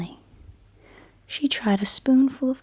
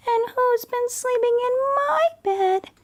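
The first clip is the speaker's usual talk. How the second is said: very high, tiny cub voice; teeny weeny Baby Bear voice; 3 dB louder than usual. Acted. Baby Bear wailing that his porridge is gone, very high and tiny. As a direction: squeak it out like the smallest bear. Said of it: "cried teeny weeny Baby Bear".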